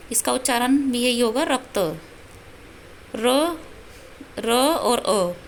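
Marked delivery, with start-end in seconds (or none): 3.2-5.4 र and अ drawn out very slowly; slow teacher spelling of र और अ; spelled out slowly. Shown as a demonstration. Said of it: "ये र का वर्ण विच्छेद है".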